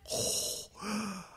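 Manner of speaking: sharp high gasp of shock; breathy startled intake; dramatic gasp. Acted. Villain appears, dramatic gasp.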